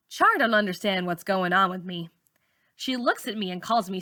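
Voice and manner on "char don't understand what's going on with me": ordinary delivery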